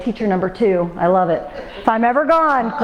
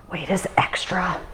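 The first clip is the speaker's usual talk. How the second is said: soft whisper, no voice; whispered aside; 3 semitones lower, 7 dB quieter than usual. Real, not acted. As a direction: drop to a whisper. Aside to the class.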